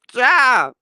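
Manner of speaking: real laughter; laughing. Real, not acted. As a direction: let laughter spill into the word. Laughing at the story.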